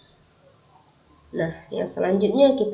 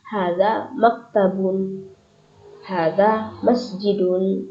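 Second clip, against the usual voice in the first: each word drawn out slowly for the class; slow, clear Arabic reading; much slower than usual. Shown as a demonstration. Teacher modeling Arabic examples for her students, slow and clear.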